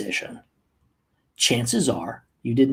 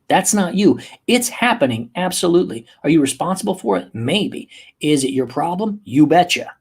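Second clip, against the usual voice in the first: usual speaking voice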